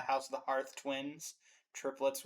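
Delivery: ordinary talk, nothing marked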